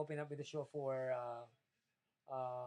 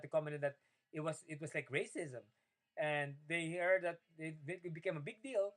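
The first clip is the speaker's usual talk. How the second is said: ordinary talk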